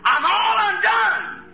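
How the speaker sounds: high, slow wail of undone; anguished lament. Acted. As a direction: stretch it out in despair. Isaiah's lament, preacher acting.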